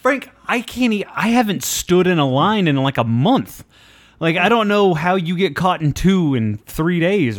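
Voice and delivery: can't believe how this keeps happening; incredulous. Real, not acted. Cohost to Frank about two line fights, loud disbelief.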